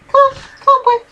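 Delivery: high urgent coaxing call; coaxing a pet. Real, not acted. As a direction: shoo the cat along, quickly. Coaxing the cat away.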